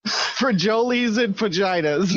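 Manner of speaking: "Frijoles" as free-JOE-leez, "vaginas" as fuh-JAI-tuhs, drawn out; mock mispronunciation. Shown as a demonstration. Teasing the co-host, "Frijoles" slowly misread as free-JOE-leez. Repeats both misreadings as a joke.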